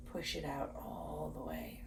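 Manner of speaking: airy breath-out whisper; whispered exhale. Shown as a demonstration. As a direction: push the words out on the last air.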